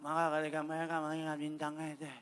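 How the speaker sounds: low, droning drowsy mumble; mock people nodding off as they read; soft, slurred reading trailing into nonsense; imitated sleepy congregation. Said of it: "ngantuk begini sih jadinya".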